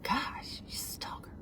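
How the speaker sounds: hushed, whispery mock thought; imagined muttered aside. Imitating her friend's likely reaction, in a hushed aside. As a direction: say it under your breath as her imagined reaction. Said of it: "she probably's like".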